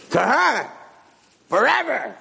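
high, gloating reply; taunting confirmation. Acted. Master sentencing kitchen boy to marriage, taunting confirmation. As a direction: rub it in high and drawn out.

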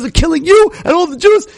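high, pressing, rising plea; urgent dramatization. Retold or imitated. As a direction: pitch it high as if disaster is happening now. Urgent hypothetical of doom.

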